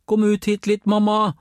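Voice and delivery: high, loud call into the house; calling out to mum. A narrator's reading. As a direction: shout for mum from the doorstep. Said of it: "ropte enda høyere". (roper høyt på mamma)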